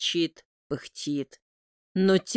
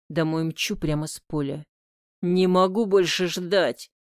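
usual speaking voice

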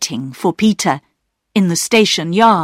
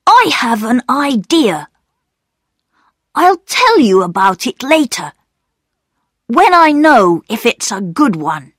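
put-on higher-pitched boy character in a read-aloud; lively storybook voice for Peter, pitched up; young Peter; 5 semitones higher, 4 dB louder than usual. A narrator's reading. Peter telling his sisters of a secret idea, pitched-up boy's voice.

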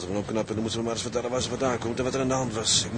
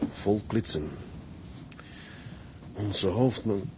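put-on slow, lower reading of the old text; reading aloud; 3 semitones lower, slower than usual. Son resumes reading the old logbook, slow and lower. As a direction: read the logbook slowly, lower. Reads the old log again.

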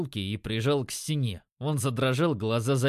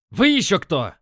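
loud, higher startled bark; angry bark; 6 semitones higher, 9 dB louder than usual. A narrator's reading. Dealer getting up at the sight of strangers, barking. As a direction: roar it at the intruders. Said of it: "рявкнул он".

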